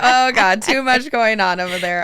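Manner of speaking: loud, high mock panic; imitating a dad fleeing the crying women; flustered dad. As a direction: ham up the overwhelmed father. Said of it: "He's like".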